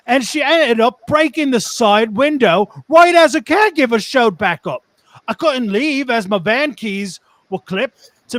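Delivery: animated, rising Cockney yarn; Cockney accent. Acted. Telling of the frying pan attack, animated Cockney accent. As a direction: spin it like an excitable Cockney. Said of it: "and a Cockney accent".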